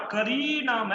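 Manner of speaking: "करी नाम" with री held long; stressed long vowel. Shown as a demonstration. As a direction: lean on री in "करी नाम" and lengthen it. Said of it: "वहां पर बलाघात करो". ("करी नाम" में री पर बलाघात, दीर्घ स्वर)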